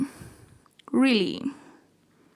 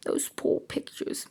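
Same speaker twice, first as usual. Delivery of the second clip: whisper; 4 semitones higher, 8 dB quieter than usual. Real, not acted. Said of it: "whispered aside".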